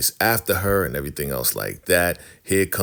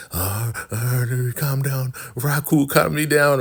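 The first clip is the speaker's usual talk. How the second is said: high spluttering plea to be calmed; imitated worked-up woman pleading; mock upset; 8 semitones higher than usual. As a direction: splutter and call out high, hammed-up. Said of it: "she's getting so upset".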